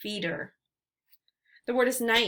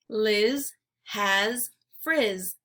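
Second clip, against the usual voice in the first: slow model sentence; much slower than usual. Shown as a demonstration. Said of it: "slow model sentence for children".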